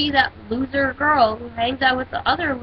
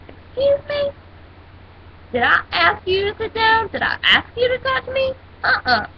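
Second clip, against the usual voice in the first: high, indignant excuse me; sassy comeback; 8 semitones higher than usual. Retold or imitated. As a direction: snap it back with attitude, pitched up. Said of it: "and I'm like".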